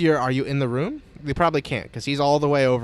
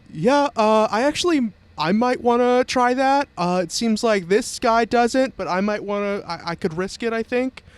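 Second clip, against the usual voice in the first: high, raised voice of an unsure volunteer; hesitant shouting voice; 8 semitones higher, 4 dB louder than usual. Acted. Second cultist offers to risk the escape, hesitant shout. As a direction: call up haltingly, pitched well up. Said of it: "you hear another voice shout out".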